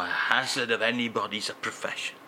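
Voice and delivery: usual speaking voice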